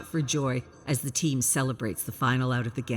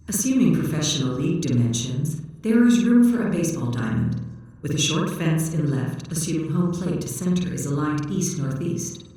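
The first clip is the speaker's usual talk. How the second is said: louder, even machine voice reciting facts; robot unit voice; 5 dB louder than usual. Acted. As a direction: read it out like a literal-minded robot. AI unit answers in computer voice.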